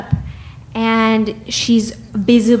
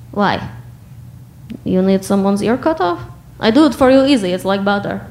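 put-on Russian accent; faster than usual. Quoting a tough Russian man.

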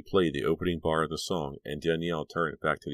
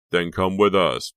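insisting; 4 semitones higher, 9 dB louder, slower than usual. Acted. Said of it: "Billy insisted".

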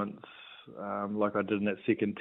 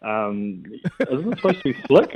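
high, louder fussy Dad; imitating Dad; 3 semitones higher, 5 dB louder than usual. Son imitating Dad's spelling correction, high fussy dad voice. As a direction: quibble like a fussy father. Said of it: "He goes".

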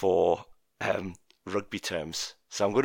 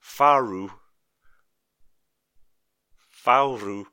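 Faru said louder each time in a mock Japanese accent; 6 dB louder, much slower than usual. Shown as a demonstration. Quiz host repeating a rugby term for the panel, in a louder mock Japanese accent. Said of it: "my best Japanese accent".

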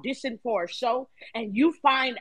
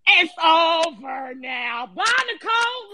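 loud, slow shouted goodbye; shouting; 4 semitones higher, 4 dB louder, slower than usual. Pretend. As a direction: belt it out like a gloating farewell. Shouting farewell at evictee.

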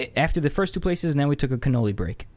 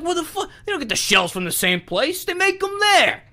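indignant local. Retold or imitated. Local dude arguing, acted quote.

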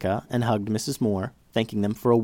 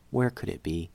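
softer, lower, almost to herself; hushed worried question; 5 semitones lower, 8 dB quieter than usual. A narrator's reading. Narrator quietly repeating the girl's worried question. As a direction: let the worry sink in quietly.

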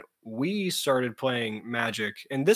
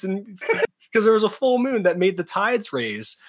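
ordinary talk

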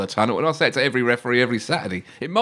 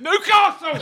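ordinary delivery

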